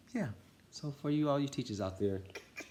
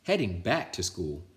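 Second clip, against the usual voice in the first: usual speaking voice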